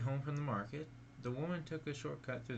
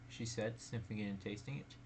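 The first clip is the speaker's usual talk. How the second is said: usual speaking voice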